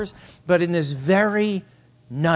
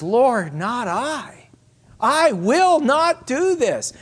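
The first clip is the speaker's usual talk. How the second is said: Peter protesting; 8 semitones higher, 3 dB louder, slower than usual. Acted.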